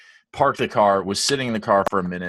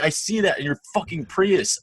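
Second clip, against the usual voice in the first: retold screaming; 9 semitones higher than usual. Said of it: "I'm, like, screaming".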